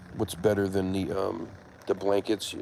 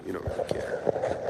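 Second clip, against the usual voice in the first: whispering to the cat; 3 dB quieter than usual. Real, not acted. Talking softly to her cat.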